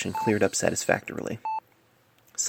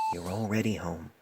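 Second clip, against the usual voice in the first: soft, lower reassurance; helpless comfort; 3 semitones lower, 9 dB quieter than usual. A narrator's reading. Painless comforting the dying patient, soft and tender. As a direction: comfort him with a gentle lie. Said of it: "he offered helplessly".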